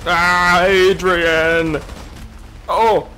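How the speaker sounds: loud panicked shout. Real, not acted. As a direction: yell the name in alarm. Getting shot, calling out.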